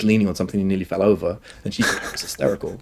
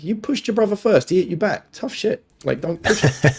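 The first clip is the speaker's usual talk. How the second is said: lightly voiced dad, pitched up; mock scolding of a child, higher voice; parent telling off; 9 semitones higher than usual. Dad retelling what he tells his daughter, mild telling-off. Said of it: "I say that to my daughter all the time".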